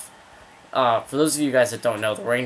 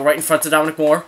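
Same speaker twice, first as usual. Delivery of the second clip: raised, louder call of a chance; higher, urgent live commentary; excited play call; 5 semitones higher, 7 dB louder, faster than usual. Real, not acted.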